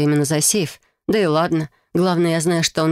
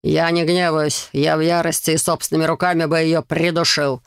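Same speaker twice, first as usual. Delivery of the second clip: plain reading voice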